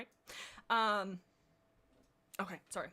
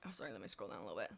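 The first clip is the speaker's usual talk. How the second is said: ordinary talk, nothing marked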